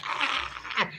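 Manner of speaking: high, breathy mock yelp imitating Polly; half-laughing imitation of an angry shriek. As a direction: squeal it like she's startled and mad. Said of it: "She was like".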